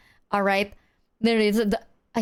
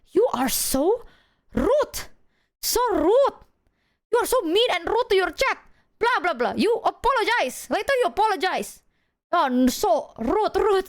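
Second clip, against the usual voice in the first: high, mocking imitation of mom scolding; squeaky mom nagging her son, hammed up; 9 semitones higher than usual. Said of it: "she was like".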